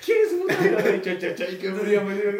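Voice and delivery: high, loud laughing exclamation; mock reaction. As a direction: shout it cracking up. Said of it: "nos quedamos cagados de risa". (exclamación burlona aguda y fuerte)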